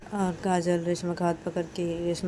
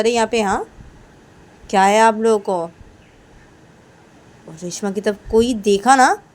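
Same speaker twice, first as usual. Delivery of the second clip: loud, higher accusing voice; confronting Kajal; 4 semitones higher, 12 dB louder than usual. Acted. Kajal threatening the teachers, loud and high. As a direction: threaten and question everyone hard.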